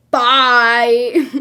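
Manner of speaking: loud, drawn-out mock "bye"; dismissive. Girl dumping a guy who questioned her, dismissive. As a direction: toss out an exasperated goodbye. Voices girl's abrupt dismissal.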